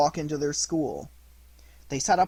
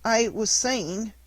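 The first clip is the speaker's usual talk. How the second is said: moaning; 6 semitones higher, 3 dB louder, slower than usual. Acted. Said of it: "moaned the puppet in a low voice".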